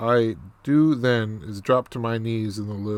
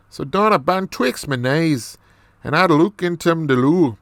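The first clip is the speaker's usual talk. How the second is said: lilting, animated mock Irish brogue; Irish accent; 4 semitones higher than usual. Storyteller amazed at what is in the loo, Irish accent. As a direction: play it up in a broad put-on Irish accent. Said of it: "more of this Irish accent".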